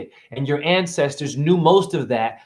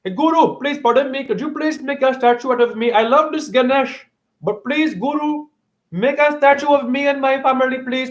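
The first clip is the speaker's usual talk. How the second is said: much higher, sweetly begging a guru; pleading devotee; 9 semitones higher than usual. Retold or imitated. A devotee asking the guru for a statue, sweet and much higher. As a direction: beg politely, like asking a favour. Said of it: "Go and challenge your priests and your popes and your gurus".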